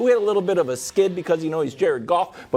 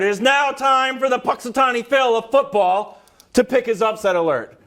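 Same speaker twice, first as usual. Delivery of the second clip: announcer intro; 4 semitones higher than usual. Pretend. Co-host grandly announcing his own pick segment, mock announcer.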